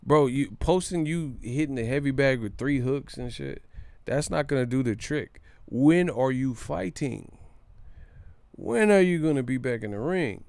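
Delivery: ordinary talk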